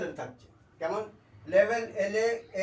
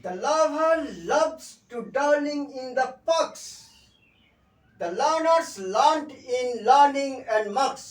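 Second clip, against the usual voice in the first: slow, high, loud recitation; chant-like delivery, leaning hard on each stressed word; tongue twister demo; 4 semitones higher, 6 dB louder, much slower than usual. Shown as a demonstration. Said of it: "এক্ষেত্রে আমরা tongue twister".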